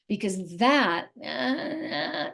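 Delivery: ordinary talk, nothing marked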